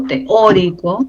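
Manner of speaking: "teórico" slowly, stressed on Ó. Shown as a demonstration. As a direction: draw out the Ó in "teórico". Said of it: "tilde en la O".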